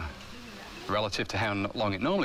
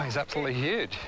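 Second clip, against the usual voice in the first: ordinary talk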